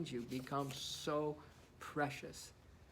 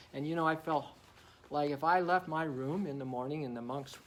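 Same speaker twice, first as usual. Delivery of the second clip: ordinary delivery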